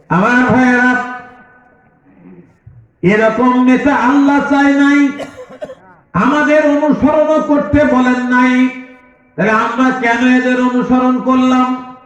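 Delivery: high, ringing challenge to the crowd; full-force preaching. Real, not acted. Preacher addressing his brothers about bad leaders, full force. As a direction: preach it high, hammering the point.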